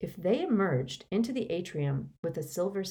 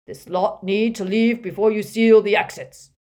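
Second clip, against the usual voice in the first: louder, firm official tone; authoritative; 4 semitones higher, 10 dB louder than usual. A narrator's reading. Harry as Runcorn ordering wizards, with authority. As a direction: assert it with borrowed authority. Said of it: "with all the authority he could muster".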